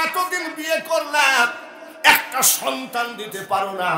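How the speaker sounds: loud, high, forceful reproach; acted scolding wife. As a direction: berate the husband at full volume. Acted wife berating her husband. (চড়া গলায় স্ত্রীর বকুনির অভিনয়)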